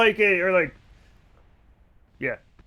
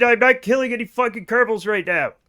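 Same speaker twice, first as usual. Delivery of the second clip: normal talking voice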